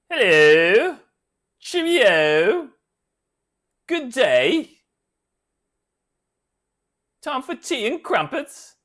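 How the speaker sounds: high, loud, hammed-up English accent. A jokey James Bond tryout to a fan in English accent. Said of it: "practice my English accent".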